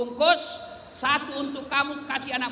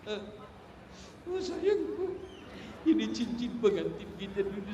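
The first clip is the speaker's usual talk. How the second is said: mock grieving widower; 6 dB quieter than usual. Voicing grieving rich man.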